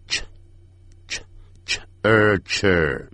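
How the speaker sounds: ch ch ch er cher, very slow, broken into sounds; ch sound drill. Shown as a demonstration. Teacher drilling the ch in teacher. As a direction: build cher from repeated ch. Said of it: "就要念成ch".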